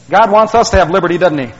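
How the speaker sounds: normal talking voice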